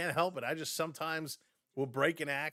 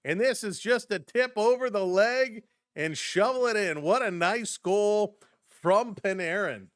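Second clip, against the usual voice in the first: raised, punchy sportscaster voice; excited replay call; 5 semitones higher, 6 dB louder than usual. Real, not acted. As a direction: still buzzing after the goal, loud commentary. Replay right after the goal.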